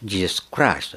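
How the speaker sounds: ジェスクライスト with a foreign-sounding ライ; 外国語風にジェスクライスト; foreign pronunciation. Shown as a demonstration. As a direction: say ジェスクライスト as je-su-ku-rai-su-to. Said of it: "フランス語ジェスクリ".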